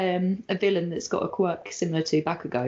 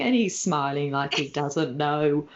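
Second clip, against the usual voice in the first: ordinary talk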